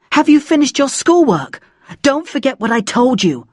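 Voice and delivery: high, scolding mother's voice; nagging mum. A narrator's reading. Mum checking on her child's schoolwork, nagging. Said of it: "always looking for something to complain about".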